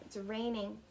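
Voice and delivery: ordinary delivery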